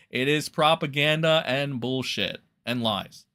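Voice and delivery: ordinary delivery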